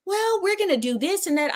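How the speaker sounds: high mocking doctor voice. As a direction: imitate her dithering. Mimicking the dismissive doctor.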